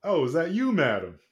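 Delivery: cocky outlaw, playing it coy; Dixie. GM voices Dixie answering.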